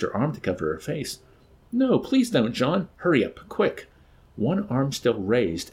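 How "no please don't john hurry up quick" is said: high-pitched panicky begging; frightened plea. A narrator's reading. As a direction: plead in fear of a blow. Woman cowering from a threatened blow.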